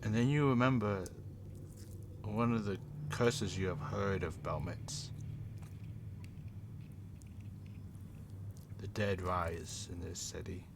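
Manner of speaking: quiet, low game-master narration; eerie scene setting. Acted. Game master describing watching eyes and the dead, eerie narration. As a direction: set an ominous scene, softening toward the end.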